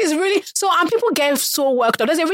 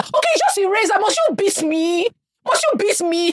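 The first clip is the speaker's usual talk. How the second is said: put-on whiny child protest; 9 semitones higher than usual. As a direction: whine like a wronged child.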